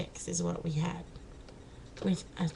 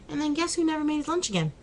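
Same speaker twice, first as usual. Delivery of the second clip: normal talking voice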